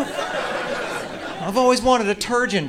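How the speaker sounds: high, slow whiny daughter voice; spoiled daughter. Retold or imitated. The daughter begging her rich dad, high slow whine. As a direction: draw it out like a spoiled brat. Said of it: "Your daddy has so much money".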